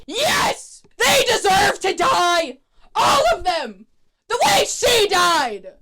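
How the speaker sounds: high, strained mock scream; unhinged yelling. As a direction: scream it slowly like a villain losing it. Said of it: "Malcolm starts yelling. He's like".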